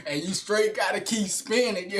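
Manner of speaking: talking through a real laugh; amused, laugh bubbling into the words. Real, not acted. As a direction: crack up, then carry on talking. Cracks up at his act.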